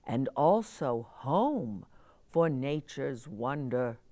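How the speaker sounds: gentle, rhythmic storybook reading; reading to children. A narrator's reading. Reading a picture book poem.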